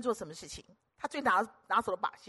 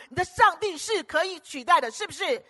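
higher and louder; louder preaching peak; rising preacher's push; 6 semitones higher, 4 dB louder than usual. Acted. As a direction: build it up to a ringing climax. Escalating repeated mocking phrase.

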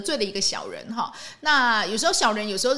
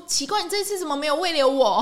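higher, taken-aback mock question; stunned surprise; 5 semitones higher, 3 dB louder than usual. Friend asking the boss why he wasn't kept, stunned. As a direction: act dumbfounded and puzzled. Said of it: "傻眼".

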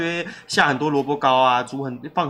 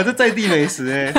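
ordinary talk, nothing marked

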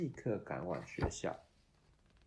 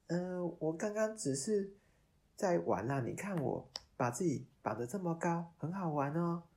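fibbing puppet; 9 semitones higher, 4 dB louder than usual. Acted. Puppet lying to fairy.

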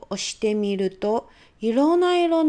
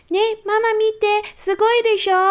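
put-on much higher, louder child calling out; excited child; 10 semitones higher, 5 dB louder than usual. Little girl calling mom to see her castle, excited and high. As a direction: show off to mom, bursting with pride. Child calls mother to look.

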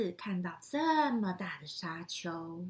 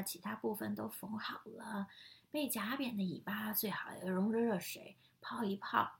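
whispering. Acted. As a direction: whisper the advice gently. Grandma explains, reader whispers. (轻声耳语)

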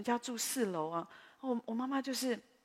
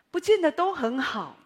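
emphatic preaching; 7 semitones higher, 10 dB louder than usual. Real, not acted. Preacher exhorts congregation.

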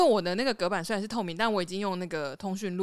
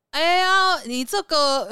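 put-on higher, louder Taiwanese-accented boss; 7 semitones higher, 5 dB louder, slower than usual. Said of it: "我们老板台湾狗也很重".

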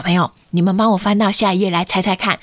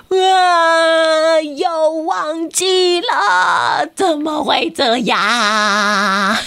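breakdown yell; 4 semitones higher, much slower than usual. Acted. Kenta yells in despair at forgetting again. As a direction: wail in dismay at forgetting again. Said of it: "健太崩溃大叫".

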